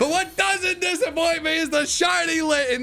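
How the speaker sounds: high-pitched burst of triumph; excited hype. Real, not acted. Streamer cheering chat on over the shiny Litten, excited hype. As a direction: crow it high and thrilled.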